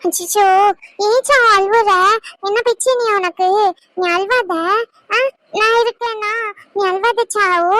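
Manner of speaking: put-on baby-talk comforting; cooing baby talk. Consoling a crying little one.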